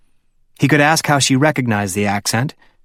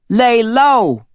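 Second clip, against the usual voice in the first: Dickie echoing; 11 semitones higher, 4 dB louder than usual. A narrator's reading. Dickie mockingly echoing Layla's advice, high and teasing. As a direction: toss the phrase back in a high teasing voice.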